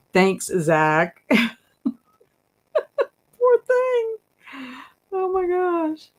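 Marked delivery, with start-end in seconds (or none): 3.4-6.1 pitying coo. Real, not acted. Pitying the emulsified groundhogs.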